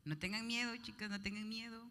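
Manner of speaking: normal talking voice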